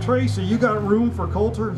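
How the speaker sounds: voiced call for a favor. A narrator's reading. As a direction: call across to a friend. Calling over to truck driver.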